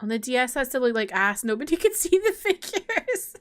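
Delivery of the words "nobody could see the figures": real laughter breaking into the words; laughing. Real, not acted. Player mocking how the DS looked, laughing. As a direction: pitch climbs as the laugh takes over. Laughs at own game remark.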